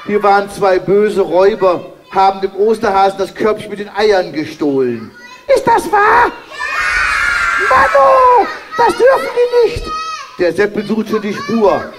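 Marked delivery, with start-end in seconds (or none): 5.5-9.8 high, shouted puppet-hero voice; squeaky high indignant puppet hero; Kasperle voice. Acted. Kasperle to the children, high and outraged.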